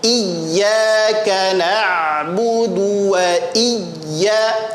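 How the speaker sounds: very slow, drawn-out Quran recitation; slow model reading. Shown as a demonstration. Teacher reads the verse for students, slow and measured. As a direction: stretch each syllable, pressing the doubled ya. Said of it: "kita baca".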